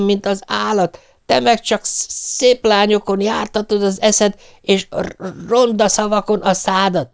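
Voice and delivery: high, stammering furious outburst; yelling wife. A narrator's reading. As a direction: stumble through an upset, high-pitched tirade. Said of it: "ordította ő".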